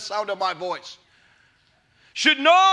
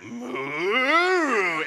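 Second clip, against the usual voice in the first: imitated cow moo. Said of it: "the thing went".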